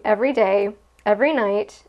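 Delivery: ordinary talk, nothing marked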